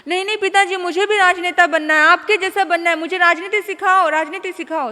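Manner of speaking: put-on a child who won't take no, pushing hard; loud whiny demand to father; stubborn pleading. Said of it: "वो जिद पड़ गया".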